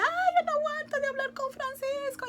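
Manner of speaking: exclamación aguda, mucho más alta; ansia exclamada. Retold or imitated. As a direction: revivir en voz aguda las ganas impacientes. Acts out her past impatient eagerness. (exclamación aguda de impaciencia)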